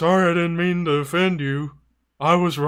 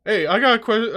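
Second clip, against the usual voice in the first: normal talking voice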